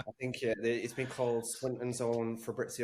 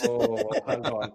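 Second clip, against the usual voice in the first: mock surprise; 10 semitones higher, 6 dB louder than usual. Mock reaction to transfer joke.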